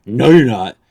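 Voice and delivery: put-on loud, flat no from the girlfriend; loud mock girlfriend refusal; sharp refusal. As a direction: shut him down hard. Girlfriend refusing in retold quarrel.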